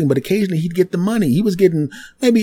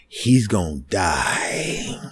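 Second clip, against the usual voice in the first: hushed awe; 9 semitones lower, 6 dB quieter, much slower than usual. Retold or imitated. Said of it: "they're looking at me like".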